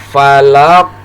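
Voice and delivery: FALAQ with the final q stopped, then bounced; bounced final qaf. Shown as a demonstration. Teacher modelling falaq for learners to repeat, bounced ending. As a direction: say falaq, stop the q and let it rebound. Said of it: "cara membacanya dipantulkan".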